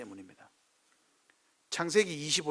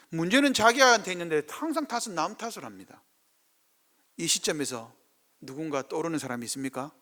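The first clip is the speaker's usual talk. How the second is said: ordinary talk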